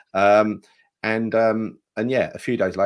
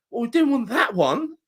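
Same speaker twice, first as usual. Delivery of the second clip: mocking retort; 12 semitones higher than usual. Mock fans' retort.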